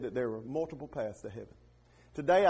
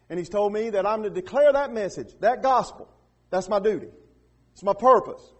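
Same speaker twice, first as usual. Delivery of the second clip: raised, declarative sermon voice; forceful preaching; 5 semitones higher, 8 dB louder than usual. Real, not acted. Preacher stating his duty to declare the gospel, forceful preaching. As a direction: declare it firmly, rising in force.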